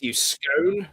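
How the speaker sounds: hammed-up English teasing; jokey mock-English voice; terrible English accent. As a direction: put on a silly English accent. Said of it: "do a terrible English accent back".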